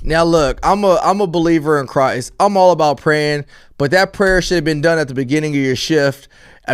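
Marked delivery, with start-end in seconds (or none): none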